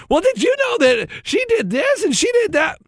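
high, bubbly gossip voice, lightly mocked; chatty sister-in-law. Husband mocks wife's sister gossiping over lunch in a high, bubbly voice. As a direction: chatter away like an excited sister catching up.

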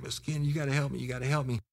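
high-pitched mock begging; retold tearful call for help; imitated crying plea. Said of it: "He was cr-, crying".

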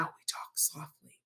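soft whisper voice. Shown as a demonstration. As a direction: whisper it like a secret. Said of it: "we whisper".

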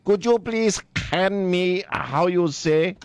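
slow, put-on Spanish accent; fake Spanish accent. Radio host mocking her cooking show appearance, fake Spanish accent. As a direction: mimic a cookery host's phony Spanish accent. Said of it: "stupid fake Spanish accent".

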